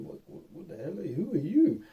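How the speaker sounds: high, rushed startled questions; startled disbelief. Retold or imitated. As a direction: caught off guard by a voice out of nowhere. Startled by voice in head.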